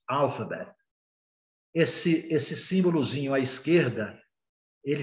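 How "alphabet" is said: "alphabet" stressed on AL; first-syllable stress. Shown as a demonstration. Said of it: "é a sílaba mais forte".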